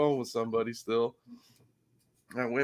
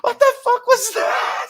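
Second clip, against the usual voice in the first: very high, louder, slow mock shriek; imitated scream; 6 dB louder than usual. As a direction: squeal it like a startled friend. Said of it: "[name] screams".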